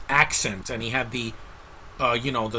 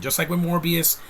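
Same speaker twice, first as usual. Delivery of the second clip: ordinary delivery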